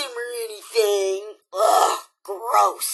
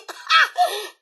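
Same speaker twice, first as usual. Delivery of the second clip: scoffing laugh. Acted. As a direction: laugh off the very idea. Scoffing at the idea of crying.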